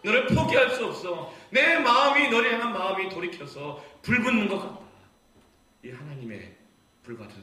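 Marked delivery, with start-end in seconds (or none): 0.0-4.9 building to loud, high outcry; burning passionate plea. Acted. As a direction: let God's heart catch fire. God's heart burning for child. (점점 커지는 불타는 외침)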